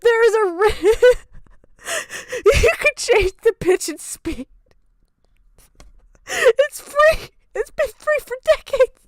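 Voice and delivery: high, squeaky laughing disbelief; laughing rant. Real, not acted. Laughing at friends for their lazy voice disguise. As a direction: rant through helpless laughter.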